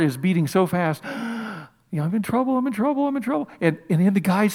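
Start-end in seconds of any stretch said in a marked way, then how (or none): 1.1-1.6 sharp, startled intake of breath; frightened gasp. Acted. Gideon terrified blowing the trumpet. 1.9-3.5 each I'm in trouble pitched higher than the last; rising panic. Acted. Gideon panicking as armies gather.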